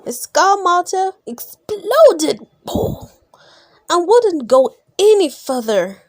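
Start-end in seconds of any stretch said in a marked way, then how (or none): none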